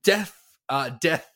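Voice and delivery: ordinary talk, nothing marked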